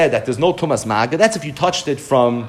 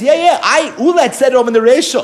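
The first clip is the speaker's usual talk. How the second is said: high, louder, quick yes-yes reply; eager admission; 9 semitones higher, 5 dB louder than usual. Acted. Rav Ami conceding to Rav Oshia, eager and high. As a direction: blurt it out eagerly.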